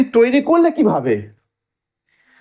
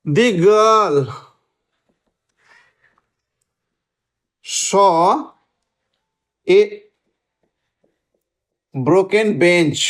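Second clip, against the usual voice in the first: word by word with long pauses; slow dictation; 3 semitones lower, much slower than usual. Shown as a demonstration. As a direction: dictate it very slowly, as if writing along. Teacher dictates example sentence while writing. (লিখতে লিখতে থেমে থেমে, ধীরে বলা)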